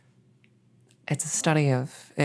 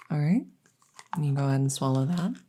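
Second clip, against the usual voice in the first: usual speaking voice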